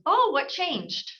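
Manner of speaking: slow, high shocked question; act shocked. Shown as a demonstration. Coach showing agent how to react, shocked. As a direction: sound genuinely surprised. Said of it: "simple inflection of your voice".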